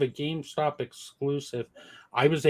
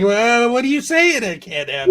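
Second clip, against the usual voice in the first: mock indignant; 9 semitones higher, 9 dB louder than usual. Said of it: "I was like".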